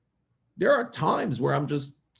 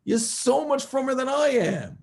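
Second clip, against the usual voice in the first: higher, louder, slow, drawn-out shout; exasperated shout; 9 semitones higher, 4 dB louder, slower than usual. Retold or imitated. Rabbi shouting back at the upset boy, slow and loud.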